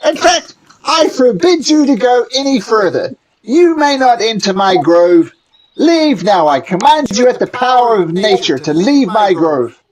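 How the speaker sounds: high, loud gnome giving orders; a tiny guardian of the grove commanding. Acted. Gnome banishes them.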